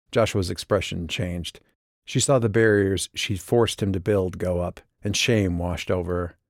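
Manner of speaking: usual speaking voice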